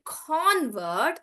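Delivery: "convert" with a long, open CON; stress on CON. Shown as a demonstration. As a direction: stretch the first syllable of "convert". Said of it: "the stress is on con".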